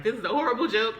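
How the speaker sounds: imitated tearful indignation; retold crying complaint. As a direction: protest as if upset, half acted. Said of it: "as I'm crying".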